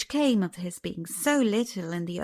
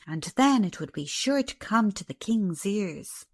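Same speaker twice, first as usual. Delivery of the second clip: ordinary delivery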